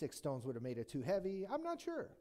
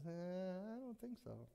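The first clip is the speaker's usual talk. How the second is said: doubtful king; 5 semitones higher, 6 dB quieter than usual. Retold or imitated. Saul doubting David.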